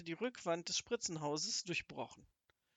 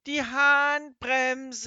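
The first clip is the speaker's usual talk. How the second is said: drawn-out, far louder, much higher yell; full shout; 7 semitones higher, 13 dB louder, much slower than usual. A narrator's reading. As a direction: bellow it over the roaring engine. Shouting over engine noise. (langgezogener, sehr lauter Schrei über den Motorlärm)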